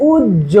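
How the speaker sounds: উজ্জ said low and soft with a doubled j; quiet, low উজ্জ holding the double j; demonstrated pronunciation. Shown as a demonstration. Teacher showing students how to say উজ্জ. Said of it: "উচ্চারণ করি কিভাবে".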